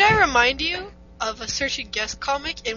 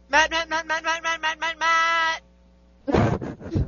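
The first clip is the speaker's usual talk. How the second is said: rapid-fire high chant of a name; insistent calling; 6 semitones higher, 3 dB louder than usual. Real, not acted. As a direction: pester a friend until they answer. Pestering friend to answer.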